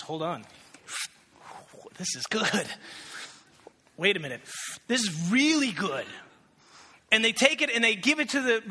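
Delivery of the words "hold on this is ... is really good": amazed tasting. Retold or imitated. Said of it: "Somebody else goes".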